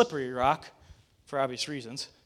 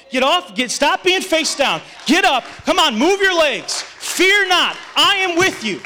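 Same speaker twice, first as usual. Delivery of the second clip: loud, high preaching shout; forceful rallying cry; 9 semitones higher, 6 dB louder than usual. Acted. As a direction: thunder it out to rouse the fallen. Preacher commands listeners to get up.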